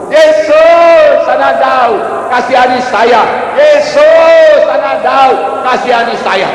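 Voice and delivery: high, loud repeated cry; Bartimeus. Acted. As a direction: stretch the name out in a high shout, then plead. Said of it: "Bartimeus masih berseru-seru". (berseru-seru dengan nyaring)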